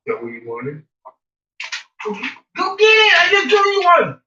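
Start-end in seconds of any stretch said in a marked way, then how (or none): 2.0-4.2 loud high fetch call; shouting to dog. Real, not acted. Owner urges dog to fetch treat, loud high shout.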